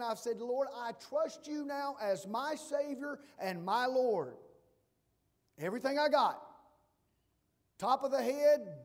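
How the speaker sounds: loud, impassioned pulpit cry; preaching at full force. Acted. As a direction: give it everything, all in. Preaching climax on repentance.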